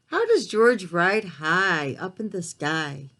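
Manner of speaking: gentle, expressive storybook reading; picture book read-aloud; reading to children. A narrator's reading. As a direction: read it aloud to little listeners.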